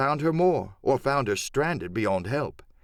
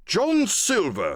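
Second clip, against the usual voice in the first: slow, higher, weighty naming; stern address; 8 semitones higher, slower than usual. Acted. Squire confronting Silver, stern and slow. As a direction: hold back anger on the name. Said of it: "he said".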